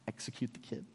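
whispered, unvoiced and hushed. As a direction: drop to a secret whisper for the grim punchline. Dark punchline about execution.

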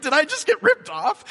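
high, loud anxious question; worried buyer. Retold or imitated. Car buyer worrying after the deal, high and loud. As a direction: fret about being cheated. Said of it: "You're like".

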